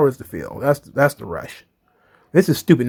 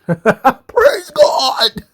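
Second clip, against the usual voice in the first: laughing relief; 8 semitones higher than usual. Real, not acted. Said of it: "relieved the feud is over".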